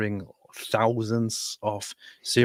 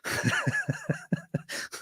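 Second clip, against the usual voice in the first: high squeaky cracking laugh; laughter breaking through; burst of laughter; 7 dB quieter than usual. Real, not acted. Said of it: "Stop laughing".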